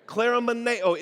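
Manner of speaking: "Kleromeneo" said as klair-oh-meh-NAY-oh, falling at the end; Greek word. Shown as a demonstration. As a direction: pronounce "Kleromeneo" in five clear syllables, klair-oh-meh-NAY-oh. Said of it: "Everybody say kleromeneo".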